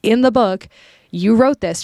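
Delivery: student confronts professor about the case study, mildly angry; retold angry retort. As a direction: confront the teacher, a mild mock anger. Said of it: "I was angry".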